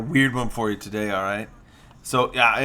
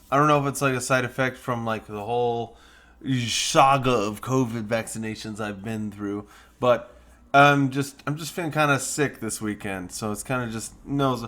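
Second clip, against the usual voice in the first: usual speaking voice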